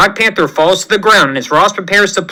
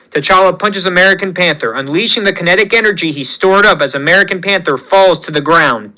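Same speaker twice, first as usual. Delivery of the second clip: plain reading voice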